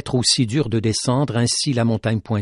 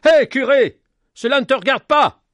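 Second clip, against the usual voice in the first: high, loud shout at the priest; 11 semitones higher, 6 dB louder than usual. A narrator's reading. Villager shouting at the priest to stay out, loud. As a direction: yell him off. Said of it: "Quelqu'un brisa le silence en criant".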